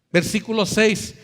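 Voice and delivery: usual speaking voice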